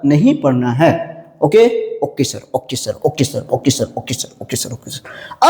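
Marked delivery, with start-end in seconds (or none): 1.4-5.5 students' "okay sir" trailing off to a near whisper; mock class murmuring "okay sir", softer and softer, slow at the end; fading student chorus. (धीमी होती छात्रों की आवाज़)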